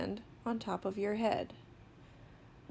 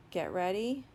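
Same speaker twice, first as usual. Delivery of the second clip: ordinary talk, nothing marked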